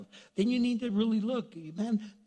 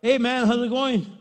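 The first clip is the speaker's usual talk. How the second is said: a loud, cheery hello; hearty greeting; 5 semitones higher, 9 dB louder than usual. Acted. Preacher acting out a cheerful guy greeting a friend, loud and hearty. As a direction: boom out a friendly greeting while missing the obvious. Greeting a man with a log in his eye.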